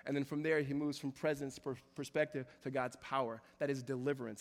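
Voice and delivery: ordinary talk, nothing marked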